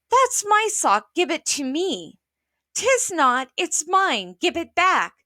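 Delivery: put-on high, squabbling kids; quarreling children. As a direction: bicker over the sock. Children quarreling over clothes.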